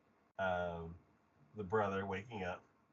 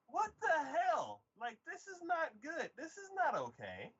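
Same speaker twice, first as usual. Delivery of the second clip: high, appalled protest; exaggerated outrage; 12 semitones higher than usual. Viewer scolding siblings over drugging, exaggerated outrage. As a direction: exclaim in incredulous disapproval.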